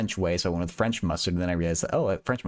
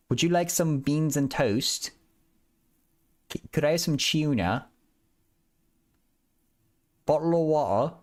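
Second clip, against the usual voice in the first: higher, hammed-up mock English; English accent; 5 semitones higher than usual. Shown as a demonstration. Streamer trying stock British phrases on chat, mock English accent. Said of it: "can I do an English accent".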